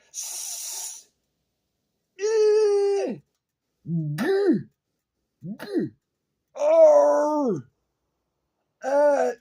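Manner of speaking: hissed s, a shouted high eh, then harsh g and r sounds; mock word noises. Shown as a demonstration. Showing words are noises, hissed and yelled fragments. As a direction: break the word into bare noises, shouting some. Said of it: "what you're really doing is just going".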